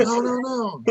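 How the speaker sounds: high-pitched mock yell; imitated yell. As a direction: mimic a panicked call from courtside without full volume. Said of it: "somebody yelled".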